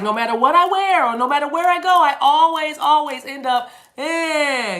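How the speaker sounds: loud, high, fast lament; hammed-up catchphrase delivery. Reader voicing the words she's hearing, hammed-up high lament. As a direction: belt it out in exasperation, high and rising. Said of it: "this is how you say it".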